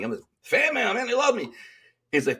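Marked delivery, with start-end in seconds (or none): 0.4-1.5 high, showy brag; cocky boast. Retold or imitated. Telling the officer about his fan mail, cocky and high. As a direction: toss it off like a cocky star. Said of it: "it's kinda being cocky, but funny".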